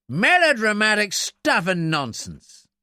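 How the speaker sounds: high, slow, scornful dismissal; sneering. A narrator's reading. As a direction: pour scorn on it. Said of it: "Briggs sneered".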